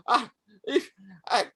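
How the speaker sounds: high, loud cowardly wail; panicked master. Acted. Slashed master blaming his vizier as he flees, panicked wail. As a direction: shriek the blame in hammed-up panic.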